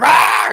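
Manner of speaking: high, loud "rah" yelp; sudden high-pitched freak-out roar; startled dog cry. Acted. Acting a scared dog out for a walk, startled high yell.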